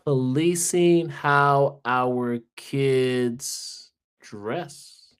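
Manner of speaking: drawn-out words, trailing into a whisper; slow, deliberating. Real, not acted. Guest picking one of three options, slow and hesitant. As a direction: stretch each word while still making up your mind. Guest slowly deciding on his pick.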